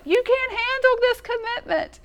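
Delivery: mock-yell it like the courtroom scene; [name] as Nicholson. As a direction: [name] done as Jack Nicholson, mock shouting. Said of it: "It's [name]'s like".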